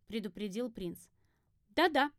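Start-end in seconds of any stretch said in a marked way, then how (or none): 1.7-2.1 quick-to-agree, higher "yes, yes"; louder, pitched-up echo; echoing reply. A narrator's reading. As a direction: toss back an absent agreement. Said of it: "эхом откликнулась я".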